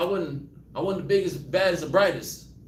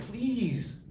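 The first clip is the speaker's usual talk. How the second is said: pleading prayer; 6 semitones higher, slower than usual. Retold or imitated. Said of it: "you're like".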